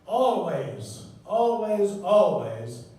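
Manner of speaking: three insistent "always", the last loudest; building repeated always. Shown as a demonstration. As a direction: hammer the word home, rising in force. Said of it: "Room, projector".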